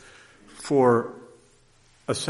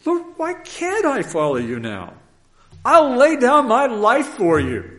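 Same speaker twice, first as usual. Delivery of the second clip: fervent Peter; 12 semitones higher, 10 dB louder than usual. Acted. Peter protests his loyalty.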